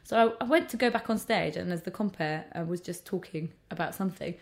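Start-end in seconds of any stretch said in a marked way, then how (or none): none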